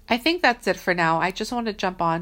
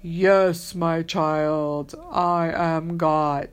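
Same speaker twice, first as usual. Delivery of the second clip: hammed-up movie deity voice, loud and drawn out; mock a grand, slow almighty voice; God; 4 dB louder, much slower than usual. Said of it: "you know, that voice like".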